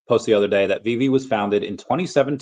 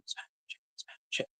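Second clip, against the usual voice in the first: whisper; 19 dB quieter than usual. Real, not acted. Urging viewers to like.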